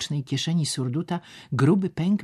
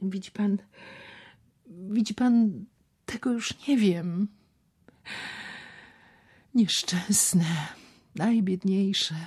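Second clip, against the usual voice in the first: frenzied whisper; 7 semitones higher, slower than usual. Acted. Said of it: "szeptał w szale".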